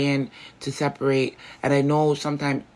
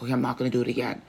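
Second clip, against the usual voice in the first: fast, soft throwaway promise; a quick, quiet empty vow; rushed promise; 6 dB quieter, much faster than usual. Retold or imitated. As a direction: rattle off the promise to God quickly.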